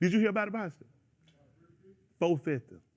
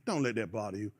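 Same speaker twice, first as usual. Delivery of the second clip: quiet; 13 semitones lower, 11 dB quieter than usual. Retold or imitated. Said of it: "I said".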